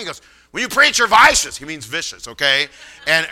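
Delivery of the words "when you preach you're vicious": imitated raspy voice, pitched up; high raspy imitation; Ryan, real raspy. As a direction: explain it like an excited raspy teenager. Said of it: "He real raspy".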